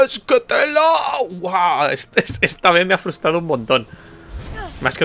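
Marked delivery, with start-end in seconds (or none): 0.0-0.8 high shout; much higher exasperated yell. Real, not acted. Exasperated exclamation repeated.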